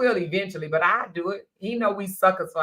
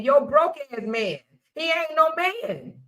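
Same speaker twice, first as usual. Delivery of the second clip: much higher and slow; scornful; 8 semitones higher than usual. Real, not acted. Host dismissing a broke boyfriend, much higher and slow. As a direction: sneer it out slowly.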